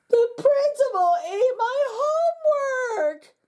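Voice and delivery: high, slow, tearful wail; crying out. A narrator's reading. Nancy wailing after the frog eats her bugs, tearful. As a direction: wail it like a heartbroken kid. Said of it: "cried Nancy".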